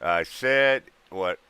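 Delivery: normal talking voice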